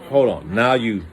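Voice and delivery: ordinary talk, nothing marked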